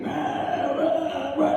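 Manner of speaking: angry monster rahs; monster growl. Retold or imitated. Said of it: "this thing, this monster, this angry".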